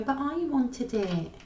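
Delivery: put-on high, slow whiny child voice; whiny young voice. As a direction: whine it like a spoiled kid. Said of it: "a really whiny young voice go".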